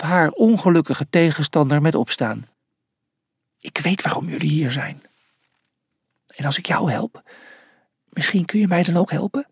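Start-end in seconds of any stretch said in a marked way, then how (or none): 3.6-9.5 hushed, confidential murmur; secretive whisper. A narrator's reading. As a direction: whisper a secret offer to an opponent. Secret talk, answered in a whisper.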